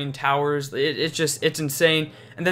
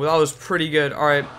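normal talking voice

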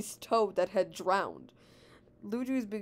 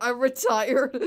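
loud, drawn-out cry; dismissive outburst; 3 semitones higher, 9 dB louder, much slower than usual. A narrator's reading. Mario refuses Luigi's plea about Daisy, loud drawn-out outburst. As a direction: belt it out slowly, hammed-up.

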